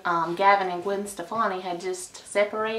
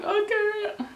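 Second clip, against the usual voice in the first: starstruck squeak; 13 semitones higher than usual. Retold or imitated. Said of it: "And I'm like".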